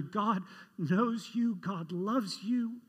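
slow, soft assurance; softly slowed, assuring each listener they are loved; tender reassurance. Real, not acted. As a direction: say it gently and slowly. Preacher reassures congregation.

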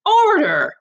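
loud, high call for order; shouted. Acted. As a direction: silence the room sharply. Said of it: "shouted Missus Barron again".

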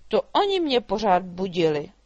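the drake bursts out in anger; angry outcry. A narrator's reading. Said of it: "vykřikl rozčileně Ludvík". (rozčilený výkřik draka)

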